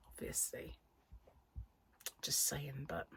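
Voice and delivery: whispered aside. Real, not acted. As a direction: drop to a breathy aside. Rueful aside on own speech.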